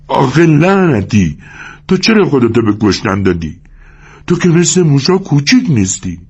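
high-pitched burst of acted anger; angry outcry. A narrator's reading. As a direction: cry out in sudden anger, drawing out the opening. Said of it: "ناگهان به خشم آمد و داد زد". (داد خشمگین با صدای زیر)

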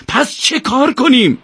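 high, slow, desperate shout; despairing cry. A narrator's reading. Yousef crying out in despair to Ezzati, high slow shout. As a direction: cry out with no hope left. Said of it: "با ناامیدی فریاد زد".

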